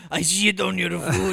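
lightly voiced Russian heel accent; Volkov impression. Echoing the joke in a light villain accent. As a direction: channel a wrestling villain. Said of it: "Fucking Nikolai Volkov".

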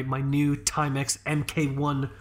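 normal talking voice

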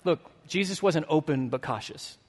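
normal talking voice

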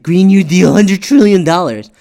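loud mocking jab; hammed-up opponent quoting attack lines; mock Trump quote. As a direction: bark it out like a sneering debater.

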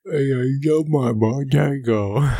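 slow, goofy mock voice; imitated weird voice. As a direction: mimic it in a drawn-out silly voice. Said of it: "you were kinda making a weird voice".

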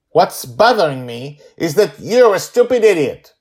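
put-on high, loud childish outburst; angry little girl. Girl yelling at her father, high and furious. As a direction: spit the insult like a furious six-year-old.

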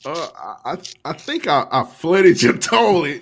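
mock confession, stretched and loud at the end; co-host's confession.

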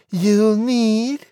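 high, loud squeaky pig; defiant piglet squeak; piggy voice. A narrator's reading. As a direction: taunt the wolf as a little pig. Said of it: "pig said".

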